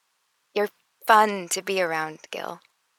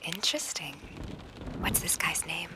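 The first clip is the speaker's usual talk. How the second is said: soft playful whisper; whispering on the phone; 2 semitones lower, 9 dB quieter than usual. Acted. Joins the whispered phone game.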